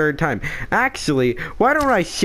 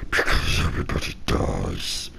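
creepy whisper; 5 dB quieter than usual. Acted.

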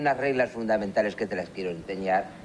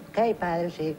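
put-on higher boyish Sí, padre; son answering; 8 semitones higher, much slower than usual. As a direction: play the obedient little boy. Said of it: "¿Estás anotando?".

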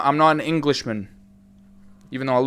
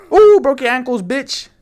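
higher, louder gloat; gloating taunt; 9 semitones higher, 8 dB louder than usual. Real, not acted. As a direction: rub it in triumphantly. Taunting after a move.

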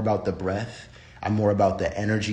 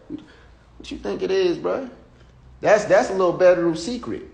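teasing; 8 semitones higher, 4 dB louder than usual. Real, not acted. Teasing viewers.

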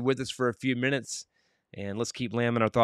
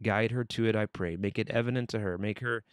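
earnest prayer; 3 semitones lower than usual. Acted. Host praying for Lamb's lost hearing aid, earnest.

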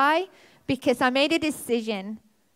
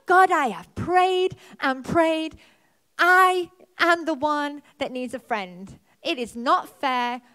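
loud, slow, higher outraged rant at God, a bit hammed-up; mock-furious complaint, louder and higher; retold furious tantrum; 4 semitones higher, 6 dB louder, slower than usual. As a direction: replay the angry tantrum, drawn out and raised. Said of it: "I was furious".

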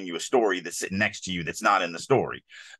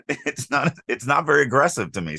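usual speaking voice

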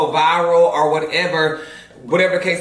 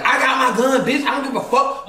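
laughing through a higher, cocky threat; retelling her own trash talk with a laugh; mock tough talk; 6 semitones higher than usual. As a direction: brag like you're strapped, half joking. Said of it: "I'm talking shit like".

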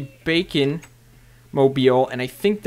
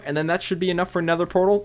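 ordinary talk

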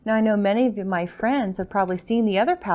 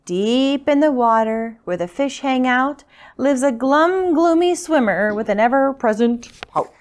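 lively, louder picture-book reading; story narration; 3 semitones higher, 5 dB louder than usual. A narrator's reading. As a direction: read it out rhythmically to little listeners. Reads picture book to children.

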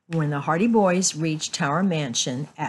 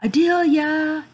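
loud, high, drawn-out call; 11 semitones higher, 7 dB louder, slower than usual. A narrator's reading. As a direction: summon her from another room. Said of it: "Then he called out".

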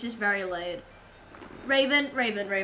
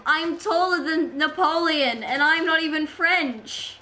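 louder, playful mock-boastful voice; put-on voice; 4 semitones higher, 6 dB louder than usual. Streamer bragging to chat about Napoleon, in a put-on voice. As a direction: put on a loud, silly voice. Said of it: "why did I do that voice?".

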